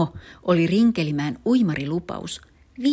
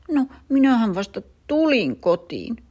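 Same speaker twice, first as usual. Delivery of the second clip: sulky; 8 semitones higher, 4 dB louder than usual. Acted. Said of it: "melkein murjotti".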